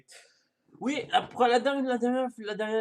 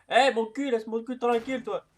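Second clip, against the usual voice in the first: higher, loud complaint; indignant protest; 6 semitones higher, 7 dB louder than usual. Real, not acted. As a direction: tell them off loudly. Telling player to leave him alone.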